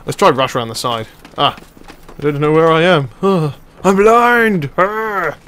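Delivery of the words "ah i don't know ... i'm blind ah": slow, high mock wookiee groans; a hammed-up Chewbacca roar; Chewbacca noise. Gamer doing a rough Chewbacca roar while blinded. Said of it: "that's my Chewbacca noise".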